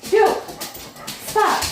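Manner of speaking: sharp high commands; yelling at dogs. Real, not acted. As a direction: bark short orders at the dog.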